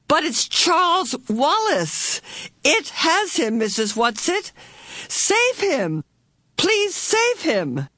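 very high, urgent begging; desperate pleading. A narrator's reading. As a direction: plead in a high voice, drawing out the last appeal. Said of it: "flung out her arms pleadingly".

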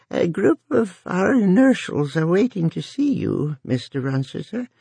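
put-on high, rasping elderly voice; quaking old woman. A narrator's reading. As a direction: rasp it out like a frail old woman. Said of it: "quaking elderly voice of Missus Frick rasped".

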